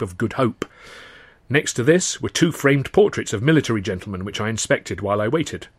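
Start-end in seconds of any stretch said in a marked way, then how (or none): none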